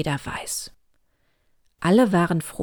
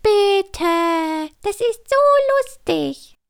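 high squeaky chick pleading; begging chick; 5 dB louder, much slower than usual. A narrator's reading. Little chick begs her mother, high pleading voice. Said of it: "Küken Klara bettelte".

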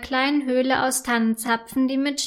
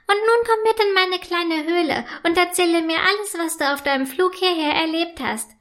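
higher fairy voice, eager; can't wait to hear everything; excited; 5 semitones higher than usual. A narrator's reading. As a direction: ice elf inviting snowflake in excitedly. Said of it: "sagt die Eiselfe aufgeregt".

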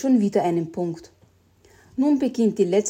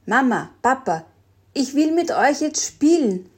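put-on higher, louder child's call; Jelly calling her dozing parents; fairly loud; 5 semitones higher, 3 dB louder than usual. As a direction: call loudly to wake them. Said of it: "sagte Jelly diesmal ziemlich laut".

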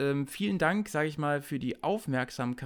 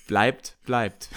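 louder, lower drawn-out trailer voice; 4 semitones lower, 7 dB louder than usual. Shown as a demonstration. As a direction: boom it like a movie trailer. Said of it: "Und jetzt so".

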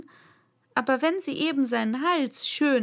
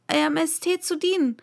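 higher-pitched, humble reply; 6 semitones higher, 3 dB louder than usual. A narrator's reading. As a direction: answer as a lowly servant to a queen. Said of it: "sagte Zwei in sehr demütigem Tone".

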